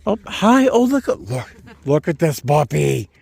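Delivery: high, delighted pet-greeting voice; gushing over a puppy. Real, not acted. Walker greeting someone's puppy on the trail, gushing delight.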